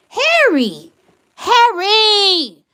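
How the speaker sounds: loud high call of the name; exasperated yell at Harry. Real, not acted. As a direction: shout his name to get his attention. Calling out the ex's name.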